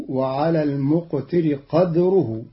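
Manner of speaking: قدره said qadruh with stopped echoing dal; Qalun's silent dal. Shown as a demonstration. Teacher showing Qalun's reading of قدره. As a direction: clip قدره to two syllables, qad-ruh. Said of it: "بإسكان الدال مقلقلة".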